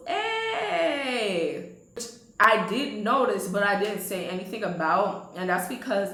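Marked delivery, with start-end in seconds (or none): 0.1-1.7 high, long falling hey; imitated drawn-out greeting. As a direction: stretch out a hammed-up hey. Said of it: "he did one of those".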